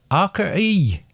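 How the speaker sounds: high West Country voice; West Country accent. Shown as a demonstration. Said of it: "West Country".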